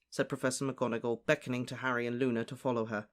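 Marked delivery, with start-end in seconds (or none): none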